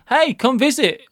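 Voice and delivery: quoted high, cheery call; friend's eager invitation. Retold or imitated. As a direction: imitate a friend calling you over, pitched high.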